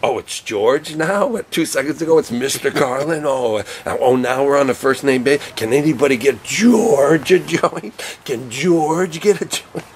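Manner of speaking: high, slow sarcastic mimicry; sarcastic mockery. Mocking comics who suddenly call Carlin George, slow sarcasm. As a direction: drag it out to mock the suck-ups.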